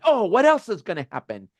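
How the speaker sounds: high-pitched exasperated mock complaint; voice of frustration. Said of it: "frustration sometimes is very big".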